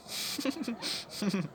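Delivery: short, soft, high-pitched chuckle; quiet little laugh between lines. Acted. Performer laughs after listing his names.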